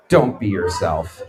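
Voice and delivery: dry low throwaway; deadpan aside. Real, not acted. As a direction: drop it flat and low like a dry punchline. Deadpan punchline to audience.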